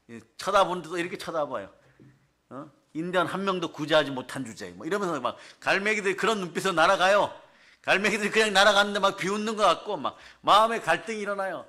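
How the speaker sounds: ordinary talk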